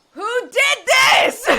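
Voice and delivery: loud, slow, half-laughing demand; fed-up mom, shouting through laughter; laughing outraged shout. Real, not acted. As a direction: yell for the culprit in disbelief. Demanding who told the kid.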